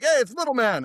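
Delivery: high, cheery retold greeting; mock calling out. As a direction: imitate locals hailing a little kid. Retold locals calling out to boy.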